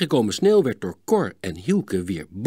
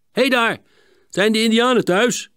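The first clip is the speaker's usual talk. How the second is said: high, louder call from outside the hut; 13 semitones higher, 7 dB louder than usual. A narrator's reading. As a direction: hail the boys inside. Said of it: "werd er geroepen". (luid roepend, veel hoger)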